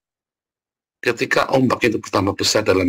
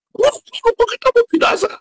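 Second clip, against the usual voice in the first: squealing falsetto panic, much higher and a bit louder; mock panic; 4 dB louder than usual. Preacher mocking panicky believers with a high squealing mock panic. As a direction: ham up a terrified cry far above your own voice.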